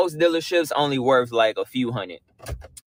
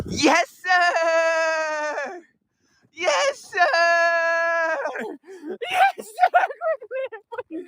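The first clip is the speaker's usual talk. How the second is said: ecstatic shouting; 10 semitones higher, much slower than usual. Real, not acted. Buyer yelling with joy over his new dream truck, ecstatic shout. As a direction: yell it out like you just won.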